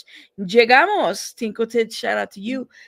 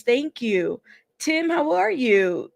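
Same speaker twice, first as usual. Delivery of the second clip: ordinary talk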